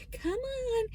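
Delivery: high, coaxing call to a little dog; sing-song come on to the dog; imitating the lady. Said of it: "telling him his name".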